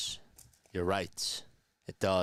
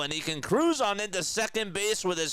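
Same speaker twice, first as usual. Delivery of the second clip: excited play-by-play, much higher; play-by-play call; 8 semitones higher than usual. Acted. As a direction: call the hit like a broadcaster.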